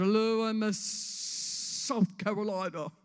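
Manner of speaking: loud, slow-swelling pageant introduction; slow, projected beauty queen voice; generic pageant queen. Acted. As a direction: present yourself grandly, stretching the state name. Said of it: "I said".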